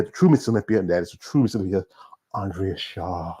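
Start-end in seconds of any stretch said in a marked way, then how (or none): 2.3-3.4 slow, soft and low; drawn-out, hushed name drop; hushed reverence. Real, not acted. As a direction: breathe the name out slowly in awe. Savoring his pick for champion.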